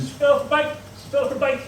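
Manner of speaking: high-pitched, practised little excuse; rehearsed excuse. Retold or imitated. Dad practising his excuse on the way down, high rehearsal voice. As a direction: rehearse the cover story in a high voice. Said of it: "I was practicing".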